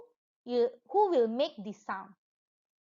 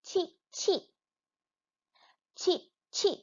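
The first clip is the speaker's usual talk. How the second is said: high chirping cheep; teacher chirping for the class; bird chirp imitation; 6 semitones higher than usual. Shown as a demonstration. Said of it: "what is the bird sound?".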